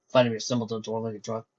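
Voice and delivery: plain reading voice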